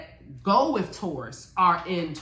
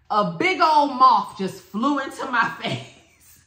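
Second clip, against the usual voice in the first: laughing storytelling; 5 semitones higher, 6 dB louder than usual. Real, not acted. Woman retelling a moth flying into her face, laughing. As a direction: tell it through laughter, louder.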